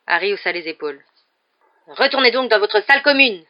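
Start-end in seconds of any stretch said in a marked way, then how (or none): 1.9-3.4 higher, louder dismissal; snarling order. A narrator's reading. Caretaker orders pupil back to the common room, surly. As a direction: send him packing angrily. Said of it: "lança Rusard ... regard hargneux".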